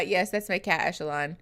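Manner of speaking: ordinary delivery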